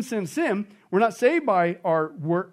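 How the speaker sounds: ordinary talk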